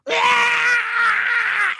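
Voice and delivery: high, loud, shrill "Ah!"; loud high scream. Acted. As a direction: scream to scare the enemy. Tribe screams to confuse.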